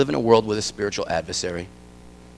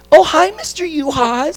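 put-on high, sing-song girly voice; girls' sweet greeting; 4 dB louder than usual. The girls greeting the dad, high and sugary. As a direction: play innocent little girls caught out.

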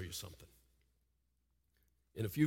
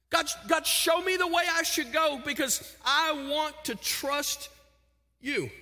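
high, louder plea to God; pleading prayer; 11 semitones higher, 3 dB louder than usual. Retold or imitated. Parent praying for guidance, raised high plea. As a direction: beg God to show the way. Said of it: "And you go".

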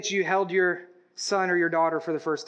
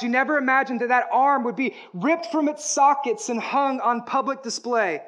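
ordinary talk, nothing marked

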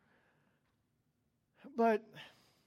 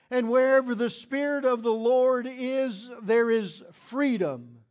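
scripture reading; 5 dB louder than usual. Acted.

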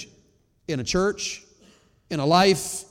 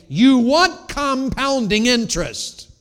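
slow and louder; emphatic preaching; 8 semitones higher, 9 dB louder, much slower than usual. Acted. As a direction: lean on each word. Loud slowed punchline.

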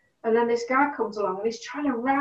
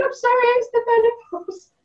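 teary apology; 13 semitones higher, 5 dB louder, faster than usual. Retold or imitated. Apologising through tears.

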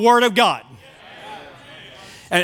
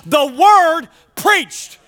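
"Word" drawn out and emphatic, then a pause; stress on Word; 4 semitones higher, 4 dB louder, much slower than usual. Shown as a demonstration. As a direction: land slowly and hard on "Word" before "preached". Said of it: "we put the emphasis on the wrong syllable".

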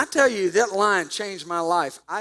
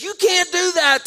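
shouted, much higher and louder; angry shout; 11 semitones higher, 8 dB louder than usual. Retold or imitated. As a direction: yell it at the coach in protest. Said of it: "And he says".

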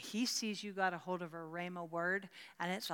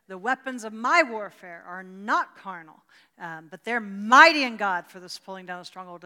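proclaimed scripture; 16 dB louder than usual. Shown as a demonstration. Reciting memorized scripture aloud.

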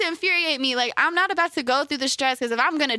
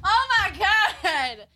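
outraged; 7 semitones higher, much slower than usual. Real, not acted. Ranting to friend about grooms who still want to cheat, outraged. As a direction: vent real disbelief at the idea.

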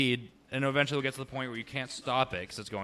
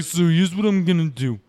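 loud, raised imitation of a drunk buddy; drunk friend's voice; 6 semitones higher, 11 dB louder than usual. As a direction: blurt it out like your drunk friend. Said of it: "he's got that voice".

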